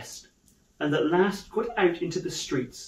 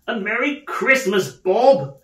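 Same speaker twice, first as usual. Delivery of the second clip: raised, jubilant Christmas greeting; hearty earnestness; 5 semitones higher, 6 dB louder than usual. Acted. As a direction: proclaim it with heartfelt joy. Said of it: "with an earnestness that could not be mistaken".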